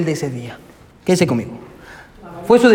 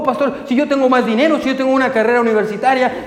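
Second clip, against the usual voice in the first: high, whiny mock pleading with a laugh; whining church member; 6 semitones higher than usual. Preacher mocks a member begging the pastor for more, whiny. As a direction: parody a member nagging the pastor.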